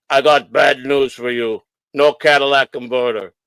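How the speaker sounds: put-on slow, measured Polish-accented English. Inspector breaking bad news to the owner, Polish accent. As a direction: imitate a Polish mechanic, slow and deliberate. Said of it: "he sounded Polish to me".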